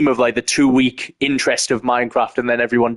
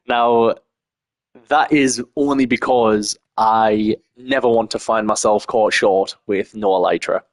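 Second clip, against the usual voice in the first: ordinary delivery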